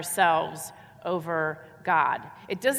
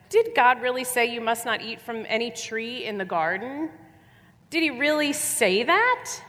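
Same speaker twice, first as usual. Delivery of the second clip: sly serpent; 5 semitones higher than usual. Acted. Preacher voicing the serpent to the woman, sly and high. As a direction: plant the doubt like a crafty snake. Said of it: "he said to the woman".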